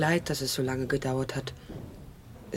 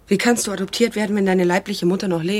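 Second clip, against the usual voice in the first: louder, higher, upset protest; indignant outburst; 4 semitones higher, 7 dB louder than usual. Acted. Mother protests loudly that her daughter was adopted. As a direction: push back hard in disbelief.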